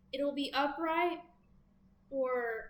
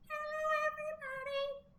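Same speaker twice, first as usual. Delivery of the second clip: much higher tiny puppet voice; Hector's squeaky voice. Pretend. Reader voicing her toy Hector to greet viewers, high and tiny. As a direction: pipe it up like a little toy buddy. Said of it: "my little buddy Hector's here too".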